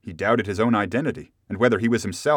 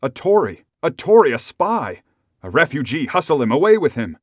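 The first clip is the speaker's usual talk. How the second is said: loud, higher-pitched shouts; crowd shout; 6 semitones higher, 8 dB louder than usual. A narrator's reading. Bystanders turn on the stranger, general shout. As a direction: shout accusations like an angry mob. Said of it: "a general shout burst forth".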